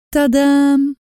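high, loud ta-da; showy fanfare. Acted. Lin flings open the cage, triumphant mock fanfare. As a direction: sing it out like a showman.

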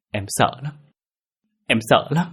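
high, fearful voice climbing on the repeat; frightened whimper. A narrator's reading. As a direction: plead for comfort, terrified. Frightened wife, repeating her fear. (run sợ, van vỉ)

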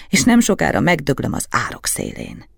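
usual speaking voice

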